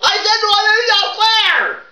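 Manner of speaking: full-voice boot camp shouting, rehearsed; rehearsed drill-sergeant yelling. Pretend. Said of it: "you don't have to yell it".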